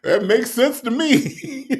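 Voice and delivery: high-pitched words squeezed out through laughter; laughing while talking. Real, not acted. Host agreeing with a viewer's joke, laughing hard. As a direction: agree while helplessly cracking up.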